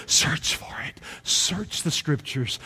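slow, hushed preacher's whisper; intense whisper. Real, not acted. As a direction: whisper the urging to the congregation. Drops to whisper after shouting.

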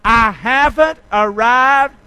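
haven't hit hard and drawn out; slow emphatic declaration. Shown as a demonstration. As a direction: proclaim it. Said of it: "Come on".

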